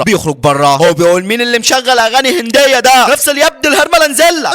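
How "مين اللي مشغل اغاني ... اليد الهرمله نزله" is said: very high-pitched, exasperated complaint; angry outburst. Acted. Man demanding who plays that Indian music, angry. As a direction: erupt in annoyance, pitch soaring. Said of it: "بيغضب".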